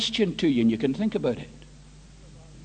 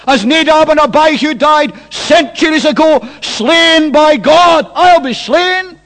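high, loud, slow cry of doom; full-force despairing preaching; 7 semitones higher, 15 dB louder than usual. Acted. Preacher voicing Isaiah doomed in the temple, loud anguished cry. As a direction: belt out each phrase like a death sentence.

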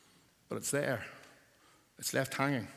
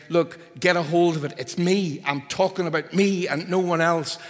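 higher and louder, insisting; emphatic; 5 semitones higher, 7 dB louder than usual. Shown as a demonstration. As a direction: drive it home like it's urgent. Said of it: "it's emphasis".

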